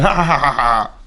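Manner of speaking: string of pleased "ah" noises; delighted bleating "ah-ah-ah"; happy wordless cries. Acted. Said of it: "s'il est très content, il va".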